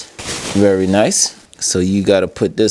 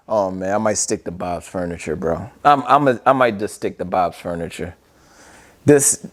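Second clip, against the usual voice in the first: ordinary talk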